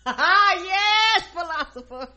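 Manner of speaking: high, loud burst of laughter into the words; laughing hard while saying yes; laughing delight. Real, not acted. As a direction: crack up and squeal the words out. Laughing at the quoted philosopher.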